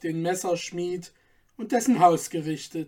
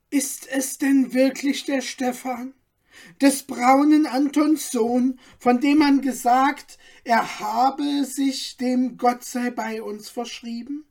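curious townsman, voiced higher; man asking; 6 semitones higher, 3 dB louder than usual. A narrator's reading. Man in the crowd asks about Stefan, curious and higher. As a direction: ask in wonder, lightly voiced. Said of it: "fragte ein Mann".

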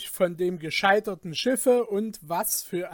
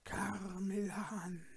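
slow, very soft, eerie call; haunting word; 13 dB quieter, slower than usual. A narrator's reading. Dark mass on the night sea calling to him, hushed and haunting. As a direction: let it sound drawn out and ghostly. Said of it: "es erklang das ihn verfolgende Wort".

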